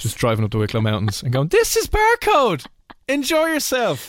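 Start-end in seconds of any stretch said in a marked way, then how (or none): 1.5-4.1 very high, slow, sing-song farewell; mock cheery mother. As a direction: chirp it like a mum waving you off. Mother dumping daughter at club.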